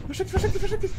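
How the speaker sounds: high urgent hide warning; hurried warning to hide; whispering while hiding. Real, not acted. Urging friend to hide.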